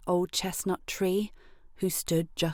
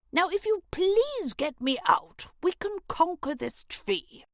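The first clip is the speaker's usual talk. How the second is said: grumpy grub; 10 semitones higher than usual. A narrator's reading.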